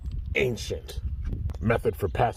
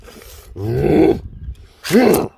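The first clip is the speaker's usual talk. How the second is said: evil laugh; 6 semitones higher, 8 dB louder than usual. Acted. Villain laughs at the battle.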